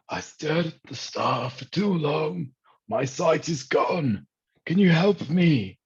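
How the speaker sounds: high, slow, rising to a loud plea; a blinded captain begging for help, voice climbing; frantic blind captain. Acted. Captain to the crew, panicked high and slow.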